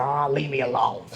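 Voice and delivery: lightly mimicked grouch brushing someone off; a hint of cartoon grump; Grinch. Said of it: "like the Grinch".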